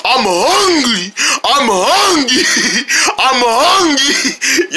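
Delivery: slow, drawn-out troll whine; grumpy troll. Retold or imitated. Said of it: "a grumpy troll going like".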